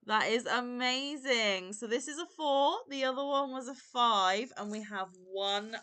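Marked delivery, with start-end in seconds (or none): 0.1-1.7 loud, slow oh my gosh; drawn-out thrilled exclamation; real delight at a gift; excited gasp. Real, not acted. Opening a gift, overjoyed.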